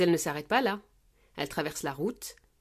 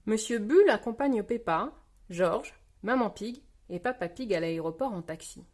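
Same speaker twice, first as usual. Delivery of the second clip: plain reading voice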